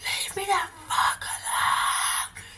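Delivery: imitating a terrified scream, held back; retold scream. Retelling the park man story, a hushed high mock scream. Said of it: "and then I screamed".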